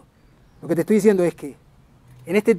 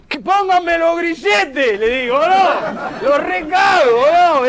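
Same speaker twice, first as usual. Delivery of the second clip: high, mounting mock outrage; stretched-out, hammed-up bravado; defiant rant; 7 semitones higher, 3 dB louder, much slower than usual. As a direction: build to a loud, defiant shout. Rants what he tells authorities.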